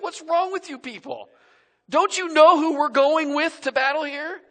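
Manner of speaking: high, loud, indignant challenge to cowering men; indignant shout. Retold or imitated. David yelling at the cowering soldiers, indignant and loud. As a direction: shout it like David scolding the army. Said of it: "and he's like".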